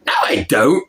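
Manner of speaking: lightly voiced cartoon character; a hint of a character impression; Ten Cents. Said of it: "fusion mix between Ten Cents's".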